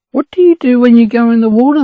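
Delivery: amazed, rush the question; astonished. A narrator's reading. Ducklings amazed the chicks never swim, high and fast.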